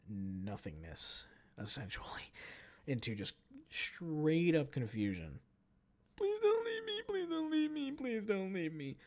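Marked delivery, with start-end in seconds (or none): 6.1-9.0 very high wailing plea, sinking slightly; hysterical crying. Retold or imitated. Unknown crier interrupting the confusion, hysterically pleading. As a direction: imitate a squeaky, hysterical begging sob. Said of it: "hysterical crying".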